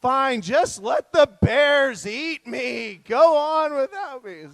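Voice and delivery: high, loud, slow theatrical despair from a tired hiker; imitating a daughter wailing mid-hike; melodramatic wail. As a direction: ham up a teenager begging to be abandoned. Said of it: "She's like".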